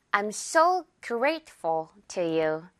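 very slow, light ful; slow demo. Shown as a demonstration. Teacher modeling the example sentence slowly. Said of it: "예문 볼까요".

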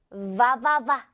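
"와와와" as va-va-va; buzzing v. Shown as a demonstration. Teacher reminding learners of sound, buzzing demo. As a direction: vibrate each "와와와" on teeth and lip. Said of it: "이 핸드폰 진동".

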